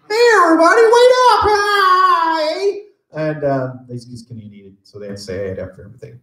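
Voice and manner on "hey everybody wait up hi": very high, loud cartoon sidekick call; squeaky high-pitched Krillin hollering for friends; high squeaky Krillin shouting hey; first Krillin voice. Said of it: "the first Krillin sounded like this".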